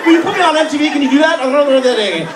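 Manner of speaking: put-on high, fast, giggly young woman gushing; girly airhead voice. Mimicking a girl gushing about fame, high and giggly. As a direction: play a bubbly wannabe star. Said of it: "she's like".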